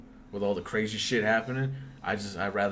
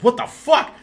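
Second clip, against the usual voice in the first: much higher and louder, shocked; shocked shout; 15 dB louder than usual. Retold or imitated. Seeing her bloody wrists, a loud shocked cry. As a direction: blurt it out in horror.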